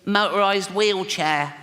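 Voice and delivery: put-on funny little high, slow child voice; strained little kid's croak; Timmy. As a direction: play a small boy with a bad chest. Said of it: "a funny little voice 'cause his all, chest is all fucked".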